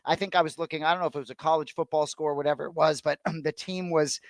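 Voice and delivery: ordinary talk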